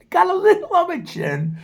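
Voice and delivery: high-pitched, laughing through the words. Real, not acted. Showing viewers his few chin hairs, laughing.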